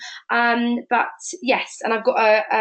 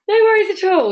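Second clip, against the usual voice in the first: bright high brush-off; 8 semitones higher, 7 dB louder than usual. Acted. Brushing off a refusal.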